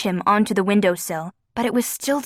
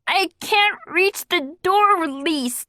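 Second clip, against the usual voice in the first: muffled; 9 semitones higher, slower than usual. A narrator's reading. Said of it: "Ozzy's muffled voice replied".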